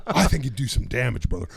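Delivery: loud, shouting wrestler promo; Hulk Hogan promo. Shown as a demonstration. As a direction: hype it up like a wrestler calling out his rival.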